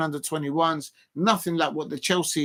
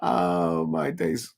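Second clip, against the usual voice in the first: much lower, very slow, drawn-out gasp of amazement; mock amazement; 11 semitones lower, much slower than usual. Reacting to a viewer's photo with a slow, low mock amazement. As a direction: stretch it out like you're stunned.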